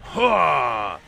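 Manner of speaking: a loud, high battle cry as he strikes; battle shout. Acted. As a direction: bark it out as the assassin attacks. Attacked by a Scarlet Assassin.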